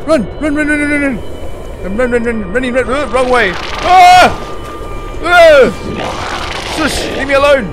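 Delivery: frantic high shouting; being chased. Real, not acted. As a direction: yell in real panic while fleeing. Chased by monster.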